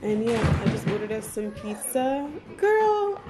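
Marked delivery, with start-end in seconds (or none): 2.6-3.2 high, loud call to the dog. Real, not acted. Owner calling the dog, high.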